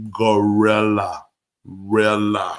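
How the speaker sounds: GORILLA stretched out slowly, syllable by syllable; drawn-out word. Real, not acted. Host names Haywood's animal, slow and drawn-out. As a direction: draw out GORILLA, lingering on each syllable.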